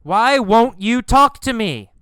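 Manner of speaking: loud, high, pleading shout; yelling. Acted. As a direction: yell after someone who is ignoring you. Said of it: "He yells".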